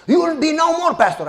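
imitating a domineering elder's threat; threatening elder. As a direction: warn him off like a boss.

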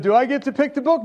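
fast, high, loud asking; hopeful bargaining; eager question. Retold or imitated. Pastor asks the man if he can pick the book, fast and high.

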